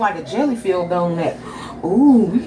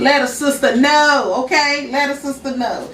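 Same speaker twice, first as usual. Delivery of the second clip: sassy; 8 semitones higher than usual. Real, not acted. Playful sign-off.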